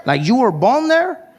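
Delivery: high-pitched disbelief. Retold or imitated. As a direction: question it as if it cannot be true. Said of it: "He's like".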